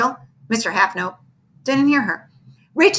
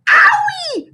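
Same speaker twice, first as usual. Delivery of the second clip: very high cry of pain; a squealed owie; 6 dB louder than usual. A narrator's reading. Kids hit by drumstick.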